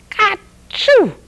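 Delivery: high, sharp pretend sneeze; bug's sneeze. Storyteller acts the little bug sneezing, high and sharp. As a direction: pop it out like a tiny sneeze.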